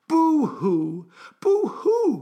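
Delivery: storybook boo-hoo with a high swoop; mock crying. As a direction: wail it playfully, sliding up high. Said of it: "cried the woo woo".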